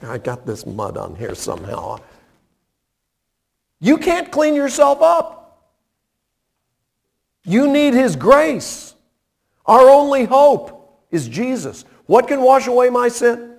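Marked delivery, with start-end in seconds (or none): 3.8-13.5 high, ringing pulpit cry, lifted up to the rafters; fervent preaching. Acted. Preacher urging the radio listeners toward grace, in a ringing pulpit cry. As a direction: preach it out to the whole congregation.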